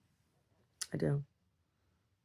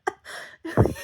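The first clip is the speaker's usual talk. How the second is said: breathy laugh; 13 semitones higher than usual. Real, not acted.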